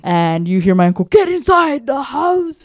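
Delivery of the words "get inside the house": high, slow, louder shout; mock yell. Uncle in the video yelling at the dog, a high shout. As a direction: bark the order to get inside.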